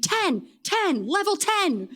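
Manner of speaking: contained, high yelp of agony for the story; retold scream. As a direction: imitate crying out in pain, pitch jumping up. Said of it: "I just screamed".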